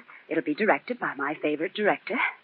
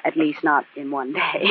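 ordinary talk

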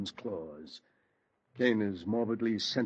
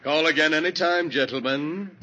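mocking farewell; 7 semitones higher, 7 dB louder than usual. Mocking farewell to leaving detectives.

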